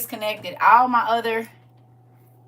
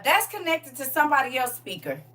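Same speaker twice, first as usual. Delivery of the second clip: ordinary talk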